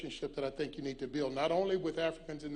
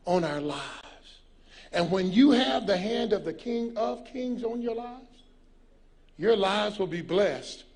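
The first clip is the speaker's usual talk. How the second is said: slow, weighty preacher's cadence; preaching; 6 dB louder, slower than usual. Real, not acted. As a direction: stretch the key words and build to a loud close. Preaches a closing blessing.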